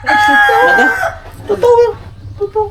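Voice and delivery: very high-pitched insistence; squealed, animated protest; over-the-top reaction. Real, not acted. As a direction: insist it's true, voice shooting up. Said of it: "Grabe makareact".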